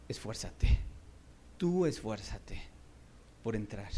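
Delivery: low, slow, breathy-soft urging; hushed Jesus. Acted. Jesus turning to the man, low and earnest. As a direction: lean in and urge quietly. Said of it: "se volteó y le dijo".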